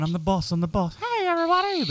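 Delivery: lightly put-on, rising to a high greeting; mock Sean in the mirror. Host mocks Sean practicing in mirror, high hammed-up greeting. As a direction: ham up a cohost posing in the mirror. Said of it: "just going".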